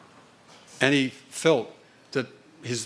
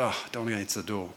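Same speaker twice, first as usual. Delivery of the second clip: mild upset mutter; 3 semitones lower, 8 dB quieter, much faster than usual. Retold or imitated. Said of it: "he was really upset".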